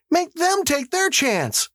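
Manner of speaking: high, slow protest at normal volume; yelled demand. A narrator's reading. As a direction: draw out a high-voiced demand. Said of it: "Missus Hutchinson yelled".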